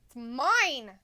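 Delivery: playful shout. Real, not acted. Snatching device from partner.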